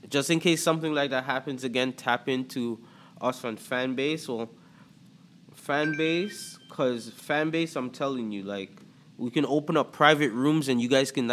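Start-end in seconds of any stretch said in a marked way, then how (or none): none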